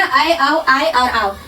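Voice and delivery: औ, आय और आओ drawn out very slowly; teacher sounding out vowels very slowly; slow pronunciation demo. Shown as a demonstration. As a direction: stretch each vowel so students hear it. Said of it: "इसका उच्चारण भी देखने को मिल रहा है".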